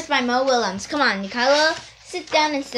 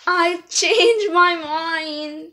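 slow backing down; 3 semitones higher, much slower than usual. Acted. Pigeon backs down.

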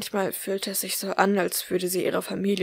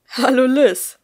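higher, louder sweet falsely innocent greeting; innocent uncle; 5 semitones higher, 10 dB louder, slower than usual. A narrator's reading. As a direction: play the angel, hiding menace. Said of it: "mit der Unschuldsmiene eines Engels". (scheinheilig unschuldiger Gruß)

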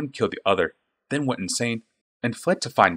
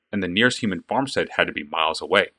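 usual speaking voice

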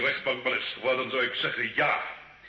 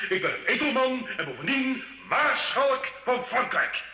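proud; 8 semitones higher than usual. Acted. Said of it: "Er is een groot toneelspeler aan u verloren gegaan".

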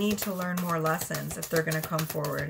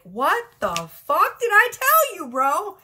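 much higher, louder, slower scolding; exasperated higher self; 9 semitones higher, 7 dB louder, slower than usual. Retold or imitated. Higher self scolding the partner who didn't listen, loud and high. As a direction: scold like a fed-up inner voice. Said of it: "their higher self is like".

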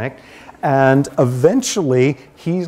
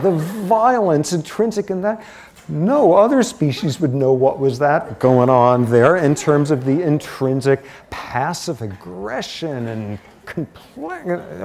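ordinary talk, nothing marked

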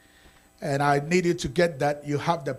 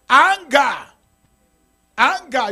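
anger shouted high and loud; shouted word; 8 semitones higher, 12 dB louder, slower than usual. Acted. Pastor shouting the sin the congregation must drop, word anger punched. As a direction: hurl the word anger at the room. Preacher hammering one word.

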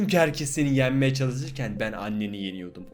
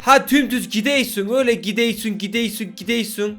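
put-on Laz accent; 4 semitones higher than usual. Fish giving directions in a Laz accent. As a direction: give directions in a Laz lilt. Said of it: "Çok Laz şivesi yapamıyorum ya".

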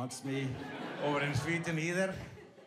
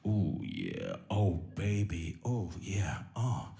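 mock porn moaning; 4 semitones lower, 5 dB louder, slower than usual. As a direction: overact a cheesy porn actor, swinging from low growls to falsetto babies. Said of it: "when they go like".

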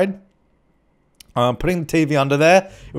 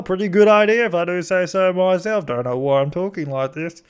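put-on silly voice, pitched up at the start; stupid voice; 4 semitones higher than usual. Vlogger jokingly bragging about the idea, in a stupid voice. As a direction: play it up in a daft character voice. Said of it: "Whenever I do a stupid voice".

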